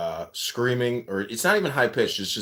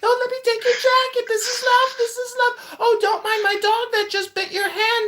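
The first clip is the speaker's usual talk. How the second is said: much higher squeaky female voice; squeaky woman's voice; 5 dB louder than usual. Retold or imitated. Friend imitating the comic's woman character, squeaky and high. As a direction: squeak like a fussing hostess. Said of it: "really squeaky women's voice".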